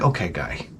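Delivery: low, soft mocking retort; quiet low dismissive mock reply. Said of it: "It's like".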